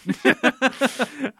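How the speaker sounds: real burst of high laughter; laughing at how devastating the scene is. Real, not acted.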